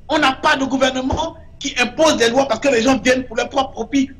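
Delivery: ordinary delivery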